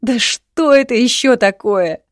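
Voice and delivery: high, loud, drawn-out wail of exasperation; tearful outburst. A narrator's reading. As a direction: cry out in helpless frustration. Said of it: "заплакала девушка". (в слезах, с отчаянным криком)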